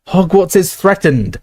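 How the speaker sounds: high, slow warning call; shout. Acted. McGonagall warns the statues, slow and high. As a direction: call it out gravely across the hall. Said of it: "shouted Professor McGonagall".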